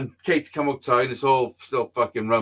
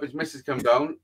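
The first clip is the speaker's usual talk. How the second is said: usual speaking voice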